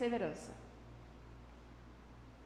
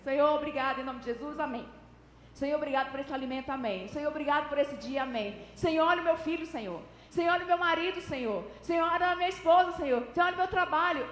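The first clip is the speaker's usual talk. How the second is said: hurried prayer; 3 dB louder, faster than usual. Acted. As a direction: rush through rote little prayers, racing onward. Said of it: "não tem como orar no modo acelerado".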